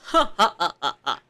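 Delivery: suspicious laugh. Acted. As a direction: laugh like you're hiding something. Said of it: "Arihiko laughs suspiciously".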